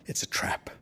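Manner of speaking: hushed, unvoiced whisper; a secret whispered warning. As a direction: breathe it so no one hears. Quiet scheming aside.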